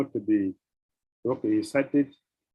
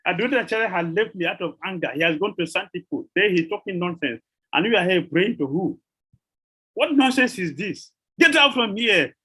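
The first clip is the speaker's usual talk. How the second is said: higher-pitched scolding tirade, building to an outburst; acted angry rebuke; 5 semitones higher than usual. The Lord ordering Srivas out from here, acted angry scolding. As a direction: rebuke him indignantly, rising to a dismissal.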